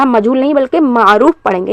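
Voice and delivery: normal talking voice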